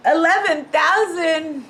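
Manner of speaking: loud, high, drawn-out wonder; amazed joy. Real, not acted. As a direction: marvel slowly at the figure. Amazed at the sale price.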